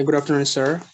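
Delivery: ordinary delivery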